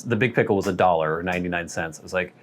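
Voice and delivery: ordinary talk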